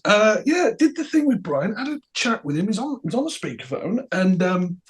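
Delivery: high, softening, hesitant voice; mock-casual, awkward hedging; sheepish report. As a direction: edge reluctantly towards bad news. Acts Rick reluctantly breaking bad news.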